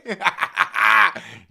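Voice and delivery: real belly laugh, full volume; loud laughter. Real, not acted. Host cracks up at a viewer's jab, loud laughter.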